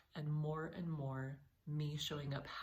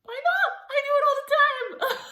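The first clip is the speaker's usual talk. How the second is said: excited squeal; 18 dB louder than usual. Retold or imitated. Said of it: "And I was like".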